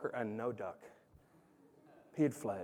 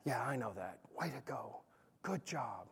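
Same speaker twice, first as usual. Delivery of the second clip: quiet praise; 9 semitones lower, 17 dB quieter than usual. Retold or imitated. Dad praising son quietly.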